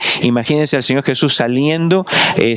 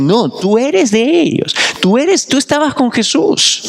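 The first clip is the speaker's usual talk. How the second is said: high, insistent accuser voice; accusing bystander; 10 semitones higher, slower than usual. Pretend. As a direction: point the finger at Peter, slowly at first. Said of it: "por el acento fuerte le tratan de decir". (acusador insistente, agudo y lento)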